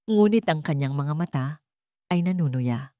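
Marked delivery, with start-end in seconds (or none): none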